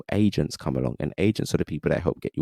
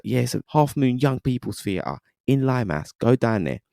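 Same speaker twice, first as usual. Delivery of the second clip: higher-pitched imitated Cockney; Cockney friend; 6 semitones higher than usual. Friend tells him where the audition is, in imitated Cockney. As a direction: imitate a Cockney mate giving directions. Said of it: "but he's proper Cockney".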